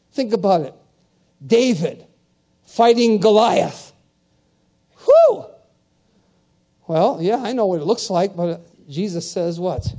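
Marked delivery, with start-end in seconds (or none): 5.0-5.5 sharp high whew of awe; high whistling whew. Real, not acted. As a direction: let out an awed whew. Reacts to Goliath fight.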